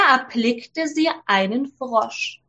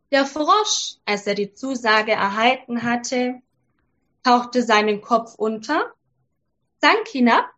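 ordinary delivery

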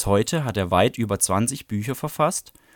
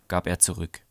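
usual speaking voice

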